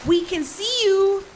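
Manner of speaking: high, slow call toward the tree line. Acted. Wife calling to a lurker in the trees, loud and slow. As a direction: call out to someone hiding in the woods.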